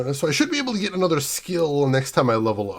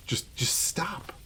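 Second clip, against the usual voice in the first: whispered plea; 12 dB quieter, much slower than usual. Real, not acted. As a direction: whisper "just, just stop" slowly, breathy and pleading. Exasperated plea about Christmas.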